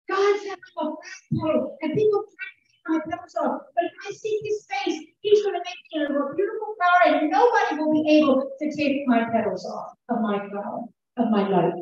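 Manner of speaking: high, tearful retelling of her words; a woman led to jail repeating the prophecy through tears; crying woman's words. Retold or imitated. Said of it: "she's crying".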